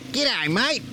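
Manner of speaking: high, mock Aussie greeting as locals say it; imitating Vietnamese locals. Imitating Vietnamese locals greeting Australians, high and chirpy. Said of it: "every Vietnamese knows how to say".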